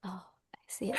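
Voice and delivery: soft unvoiced whisper. Real, not acted. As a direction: whisper while looking. Seeing the screen.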